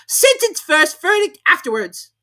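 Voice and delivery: very high, loud imperious queen; a tyrant laying down the law. A narrator's reading. The Queen to the court, high and imperious. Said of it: "said the queen".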